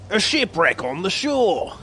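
put-on high, drawn-out Dead Island accent; hammed-up Dead Island game accent. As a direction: cry it out slowly in a Dead Island-style accent. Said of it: "Kind of the Dead Island, um, accent".

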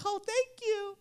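mock delighted. Dad playing along.

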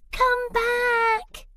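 very high, crying call after the bird; desperate shout. Acted. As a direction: plead as the cone flies off. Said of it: "shouted Cyril".

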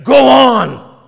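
"Go on" shouted loud as a triumphant declaration; emphatic declaration. Shown as a demonstration. Preacher ending the poem's recital with a shouted declaration. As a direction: proclaim it with full force. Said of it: "ended the poem with an emphatic declaration".

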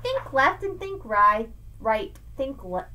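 lively sing-song picture-book read; reading to children. Acted. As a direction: read Seuss aloud to a child, bouncing the rhymes. Reads Dr. Seuss aloud.

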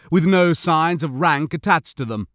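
usual speaking voice

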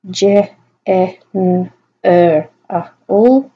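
phonemes of general, one at a time; "Je, e, n, er, a, all" as separate speech sounds; sounding out. Shown as a demonstration. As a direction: isolate each sound, pausing between. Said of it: "sound out their phonemes".

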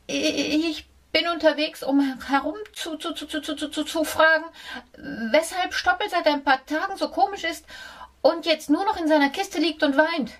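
hohe, nervöse Mäusestimme, schneller werdend; stotternde Maus. A narrator's reading. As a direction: stottere dich ängstlich durch wie eine Maus. Mouse stutters nervously. (ängstliche Maus stottert vor dem Hund)

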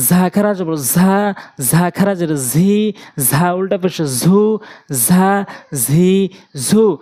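slow drill stretching ঝা, ঝি, ঝু; drawn-out long vowels. Shown as a demonstration. Teacher drilling madd of harakat, drawn-out vowels. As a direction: buzz into ঝা, ঝি, ঝু as Arabic z, vowels held. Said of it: "এক আলিফ করে টেনে টেনে পড়ব".

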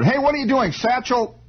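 high mock holler out a window. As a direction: call down from upstairs, stretching it high. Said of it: "he hollers out of the window".

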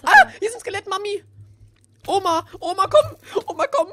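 high loud hammed-up cries for help; mock panic calling grandma. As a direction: shriek for grandma like a scared kid. Skelett, ruft Mami und Oma. (gespieltes panisches Rufen nach Oma)

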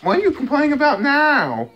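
cutesy mock telling-off. Real, not acted. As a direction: scold the cat playfully way up high. Scolding cat in baby voice.

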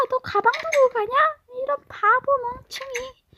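높고 빠른 놀리는 말투; 약 올리며 조롱하기; taunting classmate. Acted. (친구를 놀리는 높은 목소리)

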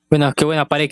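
ordinary talk

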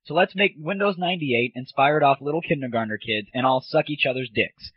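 usual speaking voice

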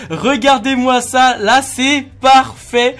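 slow, drawn-out delight; excited. Real, not acted. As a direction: savour each word, thrilled. Finds the perfect skin.

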